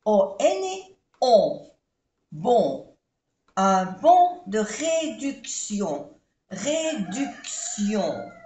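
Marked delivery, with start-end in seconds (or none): 1.2-3.0 "Bon" with a nasal on, no n sound. Shown as a demonstration. Teacher isolating the nasal on in "Bon". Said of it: "una vocal nasal, O N". 3.6-8.3 "Un bon de réduction" slow, "Réduction" stretched; slow model. Shown as a demonstration. Teacher modelling French phrase slowly for students. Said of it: "vocal nasal".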